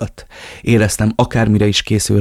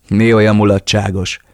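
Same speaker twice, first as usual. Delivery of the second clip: angry demand; 5 dB louder than usual. Acted. Kicks the laughing captive.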